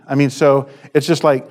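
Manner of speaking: ordinary talk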